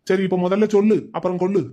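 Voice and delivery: ordinary talk, nothing marked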